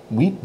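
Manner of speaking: "wheat" with a barely touched t; French eight as "wheat", t almost gone; soft T huit. Shown as a demonstration. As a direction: go light on the final t of "wheat". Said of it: "barely touched the T".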